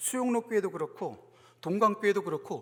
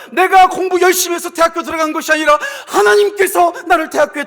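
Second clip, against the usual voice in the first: high, loud, fervent proclamation; full-force preaching; 9 semitones higher, 14 dB louder than usual. Real, not acted. Pastor urging church to credit God, shouted preaching. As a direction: thunder it out to the congregation.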